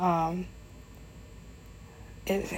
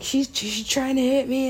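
mock whiny accusation; 8 semitones higher than usual. Drunk friend falsely accuses again.

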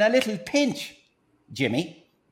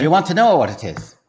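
salesman pitch in a put-on Irish accent; stage Irishman with a secret to share; Irish accent, pitching his invention to the host; 6 semitones lower, 7 dB louder, faster than usual.

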